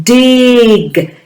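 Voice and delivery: "Dig" stretched out high, long vowel and released g; "Dig" drawn out high so each sound is heard; emphasised phonemes. Shown as a demonstration. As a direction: hold the i in "Dig", then pop the g. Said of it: "really emphasise those words".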